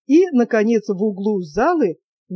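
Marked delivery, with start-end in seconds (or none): none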